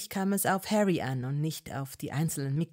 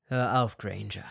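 low, clipped stop; curt warning; 8 semitones lower than usual. A narrator's reading. Malfoy stopping Hermine, low. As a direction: cut her off.

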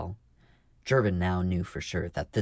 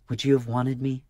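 higher, louder insistent question; pleading; 6 semitones higher, 6 dB louder than usual. A narrator's reading. Marka asking Jervin to answer her, pleading. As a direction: press earnestly for the answer.

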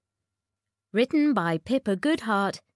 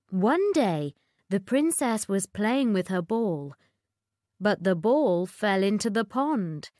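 plain reading voice

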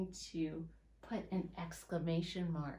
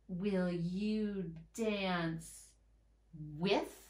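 drawn-out words, very slow; slow dictation to children; very slow reading; much slower than usual. Shown as a demonstration. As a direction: stretch each word as you write it. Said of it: "reading back as she writes".

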